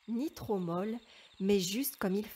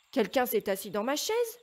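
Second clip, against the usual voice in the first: ordinary delivery, nothing marked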